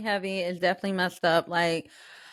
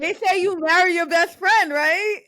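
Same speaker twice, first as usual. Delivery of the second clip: higher, louder giddy repeat; excited; 13 semitones higher, 6 dB louder than usual. Real, not acted. Backing the marriage joke, higher giddy repeat. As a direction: sing it out gleefully.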